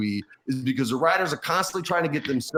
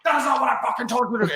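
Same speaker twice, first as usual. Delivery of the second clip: higher, mock-furious what the fuck; hammed-up boss outburst, pitched up; imitating Vince's rage; 8 semitones higher, 4 dB louder than usual. Vince shaking the writer's wrist, mock-furious yell.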